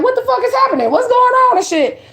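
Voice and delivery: high, slowed confusion; imitating a puzzled crowd; high mock disbelief; bewildered onlookers. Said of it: "Everybody looking like".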